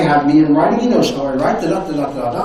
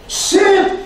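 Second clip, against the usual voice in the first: a sharp high command, much higher; insistent order. Retold or imitated. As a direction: order him into the chair. Franklin orders him to sit.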